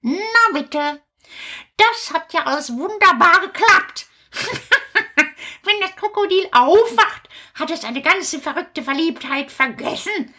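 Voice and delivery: lightly voiced puppet witch, chirpy. Witch greeting Kasperl and the children, lightly voiced. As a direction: play a cheerful fairy-tale witch.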